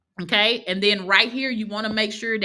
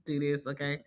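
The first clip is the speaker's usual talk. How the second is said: hushed aside; 7 semitones lower, 10 dB quieter than usual. Pretend. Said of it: "I would be like".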